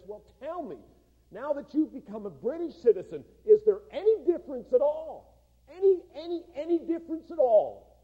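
a friend demanding an answer, building to a shout; insistent loud question. Acted. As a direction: build the "any, any" to a near shout.

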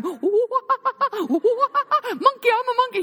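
high, slow, laughing monkey voice; playing monkey. Brother hamming up a monkey to tempt Judah, high and slow. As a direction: ham it up as a silly monkey.

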